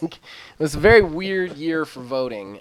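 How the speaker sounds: ordinary talk, nothing marked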